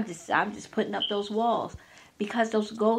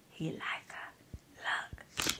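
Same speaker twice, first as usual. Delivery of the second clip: breathy whisper, voice gone; 13 dB quieter, much slower than usual. Retold or imitated. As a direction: whisper the doubt into her ear. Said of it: "some just whisper".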